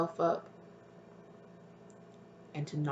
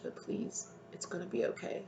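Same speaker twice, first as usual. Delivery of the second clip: soft whisper; 3 semitones higher, 9 dB quieter than usual. Acted. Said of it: "they're like".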